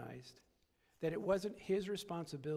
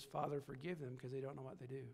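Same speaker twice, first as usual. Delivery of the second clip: soft, low forgiving prayer; tender plea; 4 semitones lower, 7 dB quieter than usual. Retold or imitated. Jesus on the cross speaks softly, forgiving. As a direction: pray softly for those who wound him. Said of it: "His ultimate response was".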